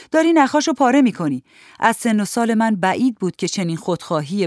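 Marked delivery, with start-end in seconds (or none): 0.1-1.4 high, loud cry of alarm; shouted protest. A narrator's reading. Child demanding the record back, shouted protest. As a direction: shout it in protest, higher and louder. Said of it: "فریاد کشیدم و صفم را خواستم".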